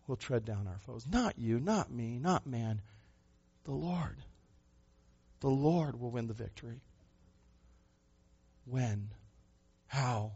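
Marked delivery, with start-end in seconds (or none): none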